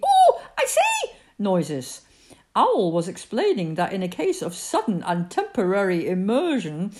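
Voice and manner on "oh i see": very high, loud yelps; excited exclamations. A narrator's reading. Piglet jumps about at the accident, high excited noises. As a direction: squeal it out in agitation. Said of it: "jumping up and down and making".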